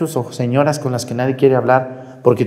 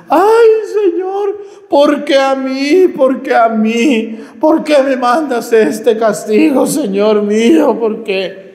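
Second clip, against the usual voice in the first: high, loud, slow wailing plea; mocking wailing woman; 12 semitones higher, 4 dB louder, slower than usual. Priest mocks a dying woman begging God, high loud wail. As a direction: ham up a sick woman's howling lament. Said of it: "estos alaridos de esta señora".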